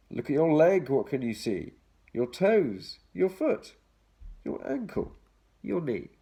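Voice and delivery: lilting read-aloud for little ones; reading to children. Acted. Reading picture book to children.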